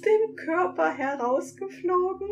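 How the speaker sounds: high, giggly sprite voice mocking; mocking creature voice. A narrator's reading. Sprite mocking the narrator, high and giggly. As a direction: parrot the words back in a high, giggly voice. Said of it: "wiederholt es lächerlich meine Worte".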